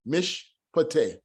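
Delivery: Mishpateh said slowly as mish-pa-teh, silent shiwa; slow teaching demo of Mishpateh. Shown as a demonstration. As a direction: spell out Mishpateh slowly, no vowel after mish. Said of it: "meaning it's silent".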